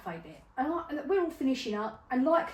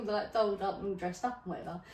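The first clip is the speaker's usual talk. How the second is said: ordinary talk, nothing marked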